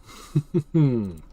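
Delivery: amused chuckle after a joke; laughing. Real, not acted. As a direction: laugh at your own joke. Laughs after pirate arr.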